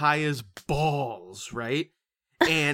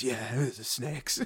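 mock weary agreement; 5 dB quieter than usual. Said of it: "he goes".

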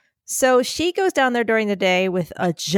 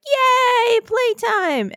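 excited dog voice; 13 semitones higher, much slower than usual. Retold or imitated. Imitating the dog Bonnie at playtime, high joyful squeal. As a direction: squeal it like a big dog thrilled to play. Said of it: "And Bonnie was like".

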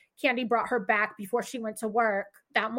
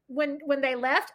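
normal talking voice

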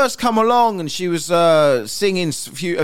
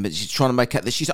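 normal talking voice

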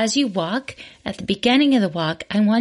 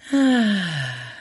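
long falling sigh after a deep breath; audible sigh out; 2 semitones lower than usual. Shown as a demonstration. Host showing listeners the second breath, falling sigh. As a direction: breathe out with a voiced, releasing sigh. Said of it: "Another deep breath in".

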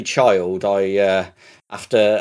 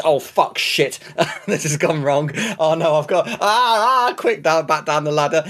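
higher pitched, hurried flustered cries; mock gamer panic; 7 semitones higher than usual. Player to himself as the level goes wrong, mock panic. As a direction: relive the moment the run falls apart. Reliving a Donkey Kong panic.

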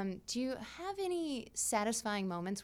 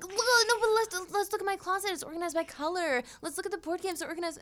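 high, eager tour of her tidy home; eager to show off her organized closet; excited showing off; 9 semitones higher than usual. Retold or imitated. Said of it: "I'm like".

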